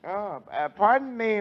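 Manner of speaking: normal talking voice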